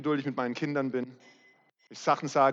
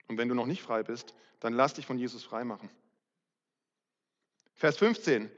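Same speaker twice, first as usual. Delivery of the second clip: ordinary delivery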